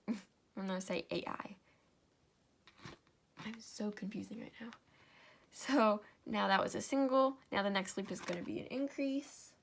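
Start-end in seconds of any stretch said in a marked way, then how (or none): 3.4-4.7 quick embarrassed whisper to herself; sheepish whispered self-remark; whispered aside. Real, not acted. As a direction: mutter it under your breath, fast.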